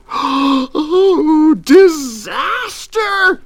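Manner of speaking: loud, high, drawn-out wail of dismay; terrified scream. A narrator's reading. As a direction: scream it in melodramatic panic. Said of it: "he screamed".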